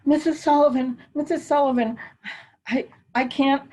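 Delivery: high, tearful mock plea of a frightened friend; imitating sobbing panic. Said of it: "breathing in and sobbing and saying".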